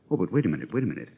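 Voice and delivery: plain reading voice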